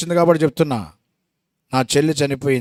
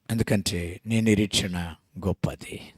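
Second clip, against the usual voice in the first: slow hushed assurance; whisper; 8 semitones lower, 11 dB quieter than usual. Real, not acted. Preacher whispering comfort to grieving believers. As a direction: lean in and whisper the hope.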